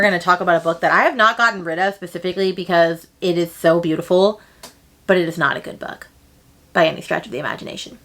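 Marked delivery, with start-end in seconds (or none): none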